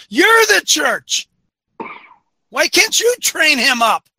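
high, slow, emphatic rebuke; indignant, stretched-out challenge; exasperated challenge. Acted. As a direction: throw it back at them, drawn out. Challenging the excuse-maker directly.

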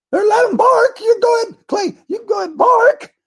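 high, loud taunting shout; mock shout. Mocking party bosses letting a congressman bark. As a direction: yell it like egging on a dog.

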